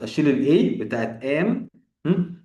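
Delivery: usual speaking voice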